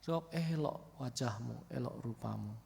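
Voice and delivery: elok rupamu said softly and gently; soft right intonation. Shown as a demonstration. Preacher shows the right tender way to read the verse, softly. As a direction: let elok rupamu fall gently and quiet. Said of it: "Itulah beda intonasinya".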